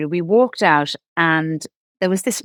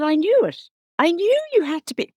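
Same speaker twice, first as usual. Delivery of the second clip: much higher, thrilled I-knew-it; retold triumph, pitched way up; excited I knew it; 11 semitones higher than usual. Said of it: "I said".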